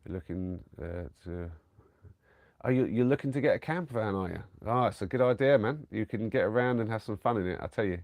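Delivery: ordinary talk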